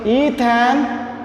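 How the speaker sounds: "ethanamine" stretched out in separate parts; slow pronunciation. Shown as a demonstration. Teacher building the compound's name for students, "ethanamine" said slowly, part by part. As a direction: draw out "ethanamine" slowly for learners.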